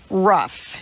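ordinary delivery

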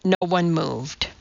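usual speaking voice

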